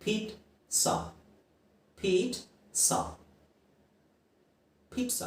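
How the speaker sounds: ಪಿಜ್ಜಾ as peet-sa, soft and breathy; ಪಿಜ್ಜಾ slowly with ts; correct pizza repeated. Shown as a demonstration. Teacher repeating the correct form, soft peet-sa. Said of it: "no, it is wrong".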